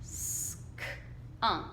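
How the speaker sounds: soft whispered Sk-unk split in two; quiet segmenting before the word; teacher coaxing the word; sounding out. Shown as a demonstration. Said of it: "What word does that sound like".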